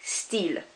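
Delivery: style said as steel, very soft; French style. Shown as a demonstration. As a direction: style with an ee vowel. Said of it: "with your French accent".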